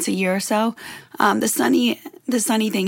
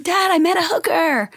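excited, high-pitched; 8 semitones higher than usual. Retold or imitated. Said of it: "and I'm like".

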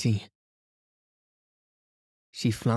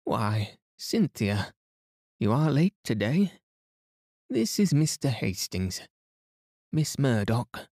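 a higher, fussing matron; voiced older lady; 3 semitones higher than usual. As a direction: play her as a chattering hostess. Mrs Inglethorpe greets Cynthia.

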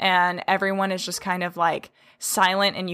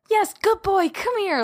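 excited praise; 13 semitones higher than usual. Retold or imitated. Owner praising an eager dog, high and excited. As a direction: praise the dog like it's the best thing ever. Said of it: "your energy is gonna be completely different".

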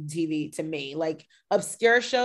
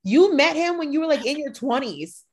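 higher, louder disbelieving retort; incredulous; 8 semitones higher, 4 dB louder than usual. Retold or imitated. Host retorts to Erica's claim about cash, incredulous. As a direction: call out an obvious lie, pitched up. Mock scolding of Erica in retold reply.

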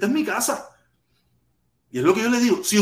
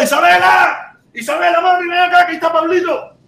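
calling out; 9 semitones higher, 3 dB louder than usual. Real, not acted. Calling his daughter across house.

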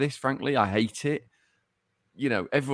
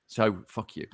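ordinary delivery

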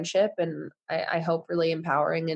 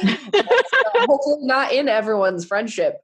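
slow, louder, laughing through words; 6 semitones higher, 6 dB louder than usual. Real, not acted. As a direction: laugh as you say it.

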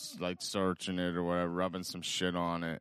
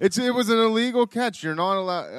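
heated protest; 12 semitones higher, 9 dB louder than usual. Real, not acted. Still protesting the catch.

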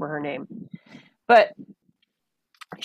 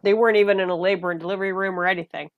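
ordinary talk

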